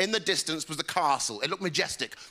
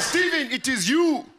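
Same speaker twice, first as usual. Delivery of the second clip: high, slow, emphatic mother; put-on mum voice; 9 semitones higher, 5 dB louder, slower than usual. Mum explaining to her young son, high and emphatic. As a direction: break it to him in a high put-on voice. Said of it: "My mum sat me down".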